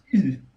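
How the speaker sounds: short high grunt, as if punched; a mock "oof" of taking a punch; imitated punched grunt. Shown as a demonstration. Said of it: "the sound you make when you get punched".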